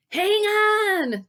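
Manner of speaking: high, loud shout. Acted. Dale yelling to boys on the branch, loud shout. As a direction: yell it across the water. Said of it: "Dale shouted".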